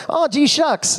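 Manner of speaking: high, bashful exclamation; aw-shucks Gil. Retold or imitated. Gil's character on Kings of Con, high and shy. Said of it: "his character on Kings of Con was like".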